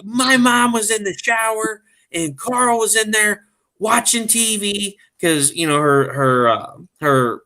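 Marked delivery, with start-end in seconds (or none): 0.0-5.0 high, put-on wife voice; mock-aggrieved high voice; imitating upset wife. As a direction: recount it as a distressed wife, pitched way up. Retelling upset wife's account.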